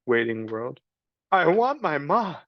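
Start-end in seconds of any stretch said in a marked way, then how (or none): 1.3-2.4 put-on high child's cry for her ma; a kid crying for her mother. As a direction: Meg crying into the waiting world. Meg repeats.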